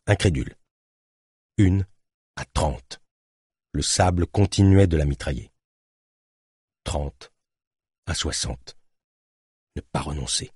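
ordinary delivery

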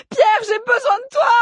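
very high, loud cry for help; desperate scream. A narrator's reading. Eléa begging Pierre to save her, desperate scream. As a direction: scream out your need, rising at the end. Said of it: "hurla plus fort Eléa".

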